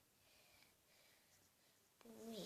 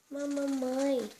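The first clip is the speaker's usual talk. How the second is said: higher, louder, slow whiny protest; pleading little girl; 6 semitones higher, 10 dB louder, much slower than usual. Pretend. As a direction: beg mommy like a small child. Filha-boneca protesta. (filha protestando com a mãe, voz aguda e arrastada)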